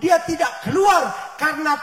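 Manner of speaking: loud, slow climactic sermon; preacher's loud climactic proclamation; preaching at full force. Real, not acted. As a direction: drive the lesson home with force.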